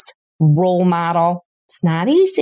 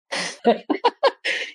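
soft breathy laugh; 10 semitones higher, 4 dB quieter than usual. Real, not acted. Laughing at failed dinner.

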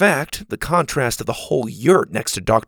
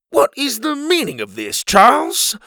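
high, indignant gentleman's voice; indignant demand; 13 semitones higher, 4 dB louder than usual. Acted. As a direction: demand an explanation, affronted. Pa confronts intruder.

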